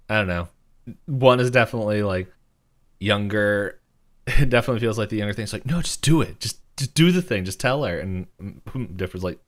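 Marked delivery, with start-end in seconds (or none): 5.7-8.0 acted urging. Acting Mabel urging Dipper on.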